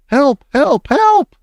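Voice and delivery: very high panicked cries, climbing; screaming. A narrator's reading. Cook begging the kitchen for help, screaming. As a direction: shriek for rescue, pitch soaring. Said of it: "the man was screaming".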